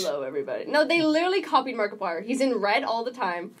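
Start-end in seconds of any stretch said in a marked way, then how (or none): 0.0-0.7 low sultry YouTuber greeting. Retold or imitated. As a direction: purr it like a sultry streamer. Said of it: "they make him do this sultry voice".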